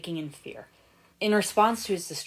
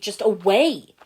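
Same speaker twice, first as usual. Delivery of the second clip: louder, higher desperate burst; desperate cry; 3 semitones higher, 9 dB louder, slower than usual. A narrator's reading. Lloyd fleeing the lava memories, desperate burst. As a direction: let the panic break out.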